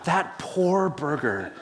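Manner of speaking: slow, drawn-out mock pity; lightly hammed-up sympathy, taken slowly; mock compassion. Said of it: "being the compassionate guy that I am".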